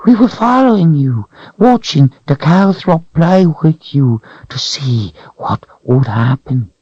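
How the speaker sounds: high whining creature voice; creature whine. A narrator's reading. Creature whining to Richard about the Calthrop. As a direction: whine it out, cringing.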